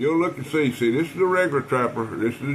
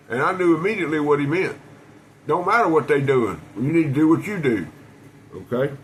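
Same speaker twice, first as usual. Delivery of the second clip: ordinary talk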